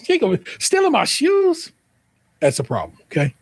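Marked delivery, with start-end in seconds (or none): 0.1-1.7 squeaky-high mock outrage; sassy retort. Scolding the sister-in-law over hidden shoes, high mock outrage. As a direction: pitch it way up in comic indignation. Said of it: "I'll be like".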